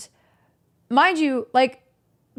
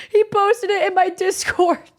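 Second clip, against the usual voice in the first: usual speaking voice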